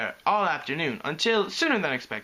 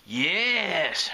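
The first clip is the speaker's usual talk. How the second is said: higher, drawn-out delighted approval; eager; 4 semitones higher than usual. A narrator's reading. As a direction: savour the word eagerly. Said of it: "she said eagerly".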